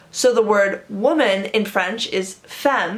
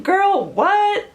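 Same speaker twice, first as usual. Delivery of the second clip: put-on high-pitched, disbelieving "girl, what?"; sassy disbelief, voice shooting up; incredulous; 11 semitones higher than usual. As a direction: react to a friend's ridiculous remark. Acted disbelief at stupid remark.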